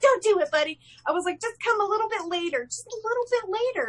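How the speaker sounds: very high coaxing mock plea; playful high-voiced begging; pleading. Begging a star to delay his visit.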